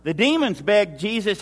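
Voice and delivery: ordinary delivery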